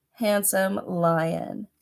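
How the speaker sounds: ordinary delivery, nothing marked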